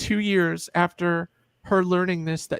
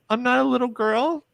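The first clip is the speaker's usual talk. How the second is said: put-on higher, rising child's protest; 6 semitones higher than usual. Child protesting to mom, higher rising voice. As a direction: push back like a small kid.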